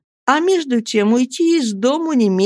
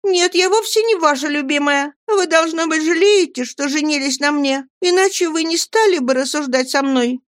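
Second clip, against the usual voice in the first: put-on high, hurt girlish voice; objecting young wife; 8 semitones higher than usual. A narrator's reading. Dora objects to her husband, high and hurt. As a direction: argue back in a high pout. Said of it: "возразила Дора".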